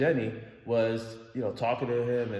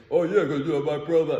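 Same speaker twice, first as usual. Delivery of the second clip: loud, higher mock drunk rambling; mimicking the drunk driver's gibberish, higher and much louder; 5 semitones higher, 9 dB louder than usual. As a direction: imitate a drunk friend rambling loudly.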